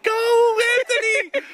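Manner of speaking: high-pitched re-enacted sideline cheer; giddy cheer. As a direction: cheer it out like a giddy fan, voice leaping way up. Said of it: "We were giddy".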